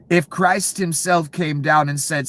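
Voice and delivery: normal talking voice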